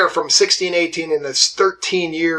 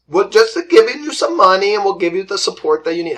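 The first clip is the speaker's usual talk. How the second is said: quick, higher mock Swedish accent that slips away; 4 semitones higher, 4 dB louder, faster than usual. Teacher voicing the Swedes to class in mock Swedish accent. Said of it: "I don't know where that accent went".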